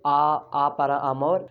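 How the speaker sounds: slow "आ para amor", vowels held open; Spanish example phrase. Shown as a demonstration. Teacher gives learners a Spanish example, slow आ para amor. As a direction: spell out आ para amor slowly. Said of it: "एक word बनता है अमोर".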